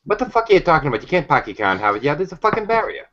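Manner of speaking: irate Bostonian with dropped r's; Boston accent. Acted. Panelist heckling the Harvard Yard line, in a mock-irate Boston accent. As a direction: argue back in a hammed-up Boston accent.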